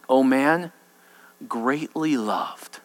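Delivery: soft, very slow, low; tender reassurance. Acted. Angel tenderly reassures Daniel, soft and slow. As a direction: linger on greatly loved.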